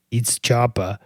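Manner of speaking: a hint of a low, gruff boyfriend; lightly voiced Bruce Willis tough guy; Pulp Fiction tough guy. Boyfriend correcting his girlfriend, lightly gruff. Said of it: "That's, that's Pulp Fiction".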